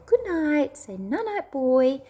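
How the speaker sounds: high, singsong goodnight prompt; mummy's high bedtime voice; parent coaxing toddler. Retold or imitated. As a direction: coax a small child to repeat it.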